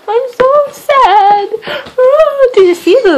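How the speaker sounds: high, slow mock wail; fake crying. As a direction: ham up a pretend sob, very high and drawn out.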